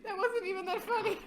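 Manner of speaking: squeezed out mid-laugh. Real, not acted. Giggling at her own joke, real laughter.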